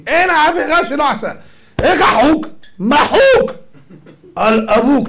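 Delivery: rising to a high, loud punchline. Acted. Rabbi to the congregation, a loud high punchline. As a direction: hit the joke hard and high. Punchline about a lawless driver.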